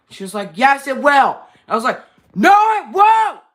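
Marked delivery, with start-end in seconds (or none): 0.5-1.4 mom arguing back. Retold or imitated. Quoting mom in argument. 2.3-3.4 high, loud angry shout; shouting back at mom in a fight. Retold or imitated.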